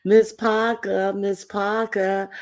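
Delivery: drawn-out high call. Acted. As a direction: call her name slow and high. Calling out a name.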